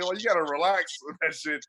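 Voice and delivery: ordinary talk, nothing marked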